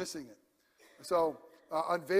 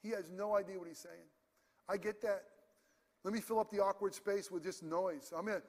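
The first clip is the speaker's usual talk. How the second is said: ordinary talk, nothing marked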